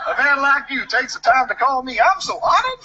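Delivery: mock honored. Man thanking the threatening caller, high laughing mock honor. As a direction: gush with amused mock gratitude. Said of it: "He goes".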